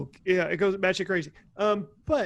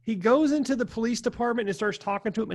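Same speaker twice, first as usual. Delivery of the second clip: ordinary talk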